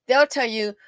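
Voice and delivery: ordinary talk, nothing marked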